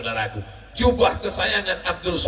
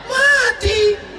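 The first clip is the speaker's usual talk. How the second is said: high wailing shout of death; wailing cry; 8 dB louder than usual. Acted. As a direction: wail the word out loud. (teriakan meratap tinggi)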